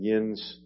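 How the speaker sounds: Yins as one syllable, a regional plural you; local dialect word. Retold or imitated. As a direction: say Yins like an Ohio Valley local. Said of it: "some of you say".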